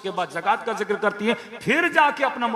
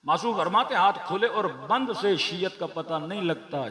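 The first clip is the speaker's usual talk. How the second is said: ordinary delivery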